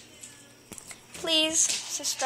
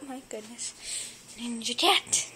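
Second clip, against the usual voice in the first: ordinary talk, nothing marked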